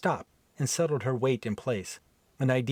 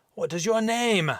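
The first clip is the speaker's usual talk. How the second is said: high barked question; gruff Viking demand; 10 semitones higher, 4 dB louder than usual. Acted. Viking demanding Helen's name, gruff bark. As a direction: demand her name like a warlord. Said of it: "rough, deep brogue accent".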